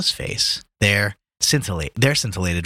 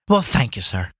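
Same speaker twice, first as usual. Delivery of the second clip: calling after him. A narrator's reading. Girl to Gabriel on the stairs, mild call after him. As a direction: call thanks after someone leaving, lightly acted. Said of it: "called out after him".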